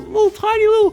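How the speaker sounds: very high, squeaky little voice; high-pitched cutesy babble; tiny cutesy voice. Acted. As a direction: squeak it like something tiny and cute. Describing tiny cute dust mites.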